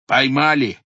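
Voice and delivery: high, loud shout of news; triumphant cry. A narrator's reading. Watchmen bursting in, loudly announcing the capture. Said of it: "вскрикнули вошедшие".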